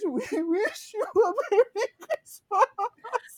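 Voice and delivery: slow high mock sobbing singing; fake crying song. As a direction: sing it like fake weeping. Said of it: "pretend like we were crying".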